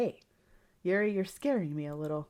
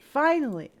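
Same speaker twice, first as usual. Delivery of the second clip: higher, louder Finally; elated Finally; 6 semitones higher, 7 dB louder than usual. Acted. Yuri alone with the player at last, a higher, louder Finally. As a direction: let the relief burst out.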